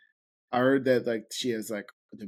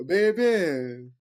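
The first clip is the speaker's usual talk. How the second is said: put-on New Orleans accent; 4 semitones higher, 5 dB louder than usual. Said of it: "that's a good accent".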